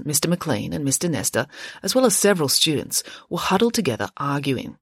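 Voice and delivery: usual speaking voice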